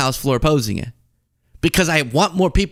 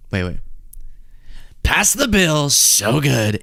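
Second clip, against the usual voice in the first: Trump impression; slower than usual. Host demonstrating for objectors, Trump impression. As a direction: do a self-satisfied president praising a bill. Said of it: "Or Trump?".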